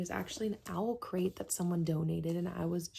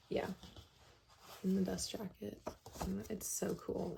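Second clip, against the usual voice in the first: ordinary delivery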